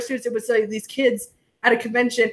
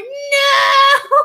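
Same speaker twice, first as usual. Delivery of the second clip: high, drawn-out comic no; hammed-up high cry of no; mock dread; 13 semitones higher, 4 dB louder than usual. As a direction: let out a pitched-up, guilty wail. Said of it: "It's like".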